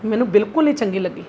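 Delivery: angry lecturing mother. Acted. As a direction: scold her daughter hard. Mother lectures daughter angrily.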